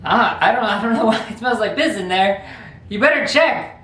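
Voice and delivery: put-on little brother faking innocence to his older brother; playing innocent. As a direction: act like you know nothing. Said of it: "and I'm like".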